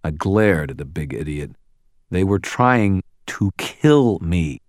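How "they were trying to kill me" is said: condescending, heavily spaced words; slow, insulting repeat. A narrator's reading. Wizard repeating himself to the big idiot, insultingly slow. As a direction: spell it out slowly and louder, as if to an idiot. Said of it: "only more slowly, you know, so it would be all insulting".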